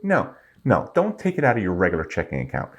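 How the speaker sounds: ordinary delivery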